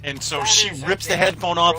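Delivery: ordinary delivery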